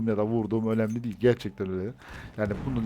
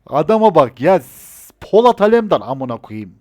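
higher, louder "Adama bak ya"; exasperated outburst; 4 semitones higher, 9 dB louder than usual. Real, not acted. Gamer raging at the enemy sniper, exasperated outburst. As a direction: rant at an unstoppable enemy.

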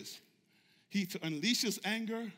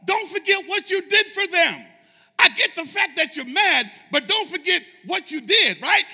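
high, loud, insistent pleading; Moses urgently interrupting; 11 semitones higher, 18 dB louder than usual. Acted. Moses reminding an angry God of his deeds, urgent interruption. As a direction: cut in hard to stop God. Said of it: "here's what Moses said".

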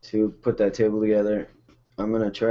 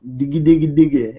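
put-on baby babble; 4 semitones higher, 6 dB louder than usual. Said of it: "she's got me saying".

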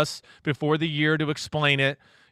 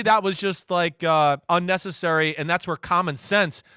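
ordinary talk, nothing marked

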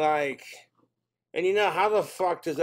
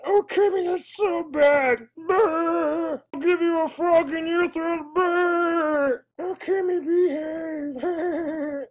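very high, loud flirty puppet voice; bad Miss Piggy voice; 14 semitones higher, 7 dB louder, slower than usual. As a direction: coo and scold like a diva pig. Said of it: "two bad voice impressions".

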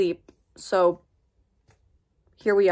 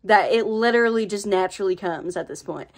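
easy, natural country accent. Shown as a demonstration. Voice actor showing viewers accents, country accent. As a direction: talk with a country twang. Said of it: "this is my country accent".

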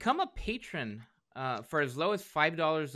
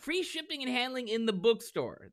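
higher, punchy infomercial pitch; ad announcer voice; 8 semitones higher than usual. Shown as a demonstration. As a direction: sell it like a TV ad. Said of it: "I didn't deliver it like that".

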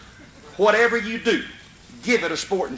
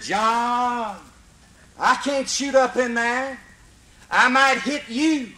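Mister Barron calling up; 6 semitones higher, slower than usual. Retold or imitated. Barron answers John up the tree.

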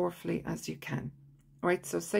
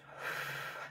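audible exhale; demonstrated breath out; 11 dB quieter than usual. Shown as a demonstration. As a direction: blow the breath out. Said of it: "Blow it out".

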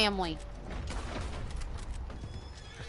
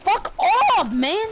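higher, louder burst of cursing; real frustrated outburst; 6 semitones higher, 4 dB louder, slower than usual. Real, not acted. Streamer cursing at the game, frustrated outburst. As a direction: curse out loud in frustration.

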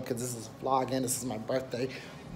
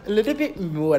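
higher, put-on DC accent, hammed up; 7 semitones higher, 4 dB louder than usual. Vlogger showing off his home DC accent, playful DC accent. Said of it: "That's my D C accent".

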